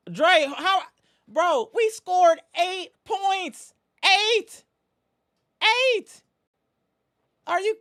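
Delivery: very high, loud, slow, stretched disbelief; can't believe the team scored eight; incredulous outrage. Real, not acted. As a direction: fan howling at the team's eight-point quarter.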